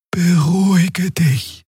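calm him gently and high; Xea soothing. A narrator's reading. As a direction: companion calming a hissing beast. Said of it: "Xea calms Kero".

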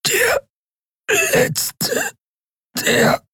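very high strangled croak, word by word; choking rattle. A narrator's reading. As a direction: squeeze out broken words while choking. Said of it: "wurde zu einem unverständlichen Röcheln". (röchelnd, fast erstickt)

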